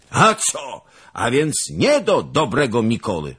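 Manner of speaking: put-on sudden high outburst of an old nobleman; bursting out. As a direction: blurt it out in surprise. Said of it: "wybuchnął Zagloba". (wybuch zdumienia)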